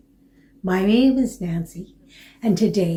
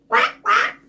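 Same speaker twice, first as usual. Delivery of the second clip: high quacking duck; 3 dB louder than usual. Acted. Dog quacks again.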